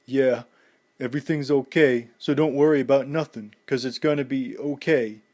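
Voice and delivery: mild faltering comfort. A narrator's reading. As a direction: try to sound sure while breaking. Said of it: "Rocky faltered".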